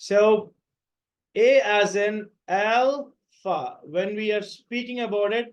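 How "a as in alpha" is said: alpha with L and fa split apart, slowly; slow drill of "A as in alpha"; slow careful spelling. Shown as a demonstration. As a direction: say "alpha" as al-fa, two clear parts. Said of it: "ఈ L అనేది different, fa అనేది different".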